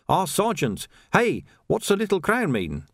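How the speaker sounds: high, eager street trader; lively high-pitched salesman patter; eager pie seller. A narrator's reading. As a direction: pitch it up like a chatty young hawker.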